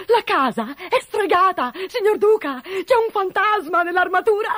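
high, loud, alarmed cook; panicked cry. A narrator's reading. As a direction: raise the alarm about a ghost in a frightened high voice. Alarmed cook warns duke of ghost.

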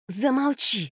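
tense, high hiss; hissed order. A narrator's reading. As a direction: hiss it to shut someone up. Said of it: "прошипела Франк". (раздражённое шипение)

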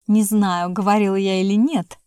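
ordinary delivery

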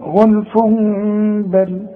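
غلف بل linked by a long hummed m; joined with iqlab. Shown as a demonstration. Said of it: "وعند الوصل عاوز اوصل". (وصل «غلف بل» بإقلاب وغنة)

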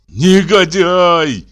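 loud, high, drawn-out denunciation; enraged insult. A narrator's reading. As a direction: hurl it slowly in fury. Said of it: "потряс кулаками". (гневно, громко, протяжно)

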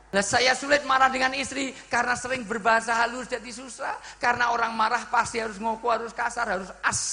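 high, shouted rush of complaint; heated loud demonstration. Shown as a demonstration. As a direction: raise the voice as angry people do. Heated outburst on how anger sounds.

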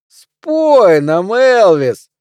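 high, loud drawn-out holler; stretched, swooping bar yell; second heckler joining in. A narrator's reading. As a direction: take up the jeer from the crowd. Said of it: "подхватил другой голос".

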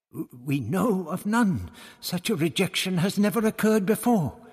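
high-pitched, stumbling priestly reply; flustered priest. A narrator's reading. Tonilla replies to Barbara about the law, flustered. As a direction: answer high, with a small stammer at the start.

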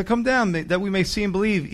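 high mock taunt; mocking crowd. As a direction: call it out like a jeering onlooker. Acts out the mockers again.

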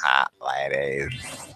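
low, soft, drawn-out leer; mock ogling. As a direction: echo it low and slow, hamming the lust. Echoes the mock ogling, lower.

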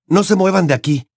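commanding. A narrator's reading. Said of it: "ordenó en medio del viento huracanado".

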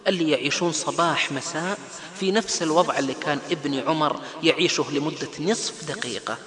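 ordinary delivery

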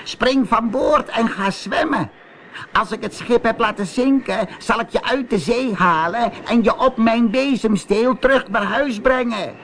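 high-pitched witch call; calling sea witch. Acted. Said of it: "riep de zeeheks". (roepende zeeheks, hoge stem)

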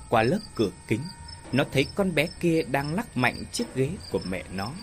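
ordinary delivery, nothing marked

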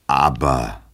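slow, drawn-out brush-off on nein; dismissive refusal. A narrator's reading. Double brushes off the desperate hero, dismissive. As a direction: wave the plea away. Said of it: "antwortete ablehnend".

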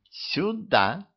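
put-on high drunken squeak; drunk lady. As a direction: squeak it out tipsy. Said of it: "ткнула пальчиком мадам".

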